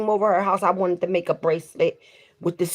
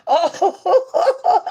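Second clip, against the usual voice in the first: laughing oh; 12 semitones higher, 6 dB louder than usual. Real, not acted. Laughing reaction.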